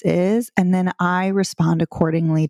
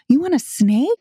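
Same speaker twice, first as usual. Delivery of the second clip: playful mock surprise; 6 semitones higher than usual. Shown as a demonstration. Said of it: "That's my silly little, like, test the water warm up".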